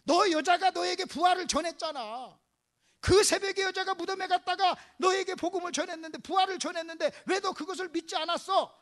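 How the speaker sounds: mildly heated scolding; acted rebuke. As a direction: press the reproach point by point. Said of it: "이렇게 책망할 수도 있었어요".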